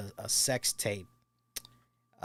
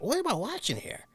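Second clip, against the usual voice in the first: shocked; 10 semitones higher than usual. Retold or imitated. Retold shock at tape.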